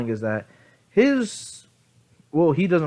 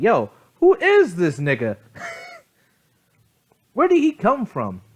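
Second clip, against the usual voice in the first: high, incredulous outburst with a laugh; mock astonishment; 10 semitones higher, 3 dB louder than usual. Examinees gawking at a stranger from nowhere, mock astonished. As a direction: exclaim it in hammed-up disbelief. Stunned exam-takers react to newcomer.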